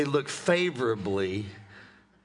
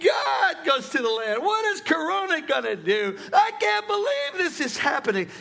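high, drawn-out wailing lament; loud mock wail; 14 semitones higher than usual. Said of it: "Then a loud wail".

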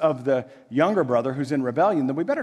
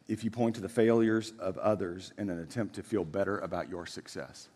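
much softer, lower earnest plea; soft solemn warning; 8 semitones lower, 7 dB quieter than usual. Acted. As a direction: lower your voice to warn them gently.

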